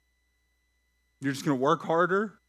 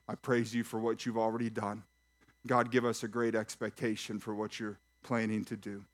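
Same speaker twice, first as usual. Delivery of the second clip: hushed, reverent prayer to God; closing prayer; 5 semitones lower, 5 dB quieter than usual. Acted. Pastor closing the service in hushed, reverent prayer. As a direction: lower and softer, speaking to God.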